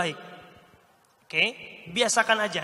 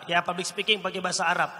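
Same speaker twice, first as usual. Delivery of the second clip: normal talking voice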